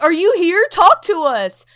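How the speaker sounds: loud ghost-hunter summons; mock calling out. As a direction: call out into the dark room.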